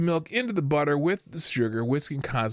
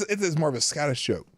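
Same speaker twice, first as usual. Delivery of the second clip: ordinary delivery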